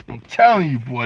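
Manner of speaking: ordinary talk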